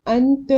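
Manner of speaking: अंत with a clearly sounded n; full noon. Shown as a demonstration. Teacher showing the reading to avoid, full noon. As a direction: pronounce the n in अंत completely. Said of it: "पढ़ने के बजाय".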